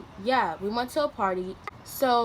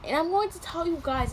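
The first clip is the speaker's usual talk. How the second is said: normal talking voice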